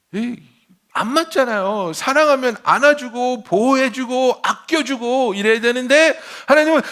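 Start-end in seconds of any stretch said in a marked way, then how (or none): 1.9-6.8 loud, high, fervent preaching; full-force preaching. Real, not acted. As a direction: drive the point home to the crowd.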